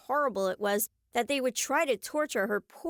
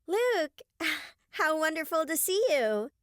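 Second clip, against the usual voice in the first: high, breathy-sighed fake delight; gushing; 5 semitones higher than usual. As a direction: fawn over him with a sigh. Julie fawning over Luke.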